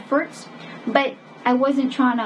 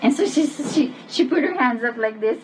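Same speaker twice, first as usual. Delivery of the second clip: ordinary talk, nothing marked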